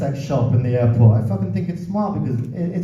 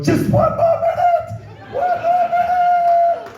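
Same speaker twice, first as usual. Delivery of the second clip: very high, slow, strained squeal; mock desperate woman; slower than usual. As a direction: shriek it like a woman who can't stop. Woman on plane, voiced desperately.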